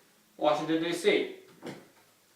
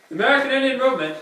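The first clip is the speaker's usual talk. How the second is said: very fast, higher announcer read; rushed report reading; 7 semitones higher, 3 dB louder, much faster than usual. Acted. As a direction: blurt the title out quickly. Same phrase, faster and higher.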